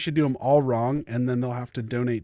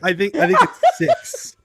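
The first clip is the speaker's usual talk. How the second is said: laughing; 6 semitones higher, 6 dB louder than usual. Real, not acted. Guessing amid laughter.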